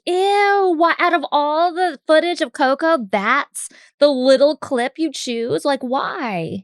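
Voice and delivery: high drawn-out "ew" of mock disgust; teasing disgusted complaint; giving him shit. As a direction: needle him, starting high and appalled, easing down. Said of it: "we're giving Kevin shit".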